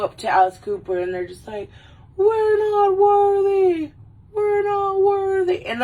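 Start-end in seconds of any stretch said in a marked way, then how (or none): 2.2-5.7 high, drawn-out mock grovelling; long, high-pitched mock chant of awe; worshipful wail. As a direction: wail it like an adoring fan. Fans grovelling before [name], acted.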